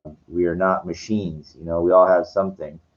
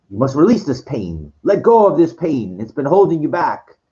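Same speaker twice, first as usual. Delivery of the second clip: higher-pitched, insistent retold plea; imitating urging cult healer; 8 semitones higher than usual. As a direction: urge them like a persuasive healer. Retold Sybok urging release.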